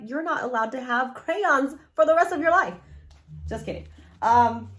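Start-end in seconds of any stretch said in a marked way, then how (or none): 0.0-2.7 higher and louder, parent scolding in jest; mock scolding. Mother pretending to scold her kids, louder and higher. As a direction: pretend stern parent laying down the law. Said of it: "I'd be like".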